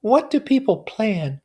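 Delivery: high, loud helpless question; high whiny helplessness; helpless Daisy. A narrator's reading. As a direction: ask as if utterly lost. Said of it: "turned to me helplessly".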